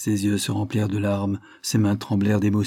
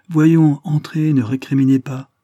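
loud, higher-pitched order to go in; raised voice, impatient command; brisk urging; 5 semitones higher, 6 dB louder than usual. A narrator's reading. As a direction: hurry him inside, brooking no argument. Lawyer hurries reluctant steward inside.

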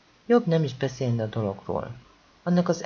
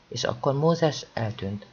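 ordinary delivery, nothing marked

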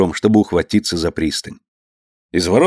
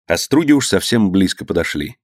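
plain reading voice

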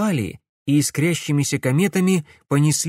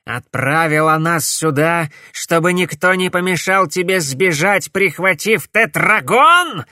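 loud, higher accusing yell, slowing on тетрагон; angry shout; 5 semitones higher, 5 dB louder than usual. A narrator's reading. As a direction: hurl the accusation furiously. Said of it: "злобно крикнул Корнелиус".

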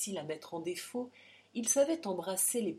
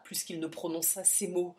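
ordinary delivery

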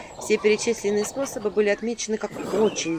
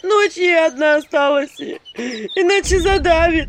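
wailing village woman; 10 semitones higher, 8 dB louder, slower than usual. A narrator's reading.

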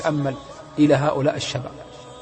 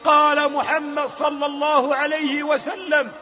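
impassioned sermon, raised voice; fiery preaching; 10 semitones higher than usual. Acted. The preacher warns the youth, impassioned. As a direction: preach hard at the young.

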